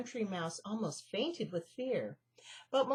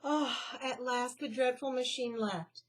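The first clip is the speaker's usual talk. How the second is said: ordinary delivery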